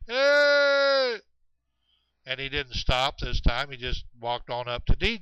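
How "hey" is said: long, loud, high holler. Retold or imitated. The thing calling after Chet as he walks, hollering. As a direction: send a high call far across the woods. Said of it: "the thing hollered".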